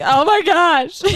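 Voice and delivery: high-pitched mock exasperation; exasperated. Retold reaction to child's breakdown.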